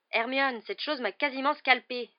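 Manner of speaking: high, loud complaint; protesting. A narrator's reading. Boy to friend about her attacking cat, loud protest. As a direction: protest with indignant force. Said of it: "protesta Ron".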